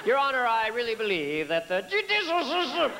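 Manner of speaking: hammed-up tipsy courtroom plea; drunk-sounding. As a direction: slur judicial like you've had a few. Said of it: "without sounding drunk".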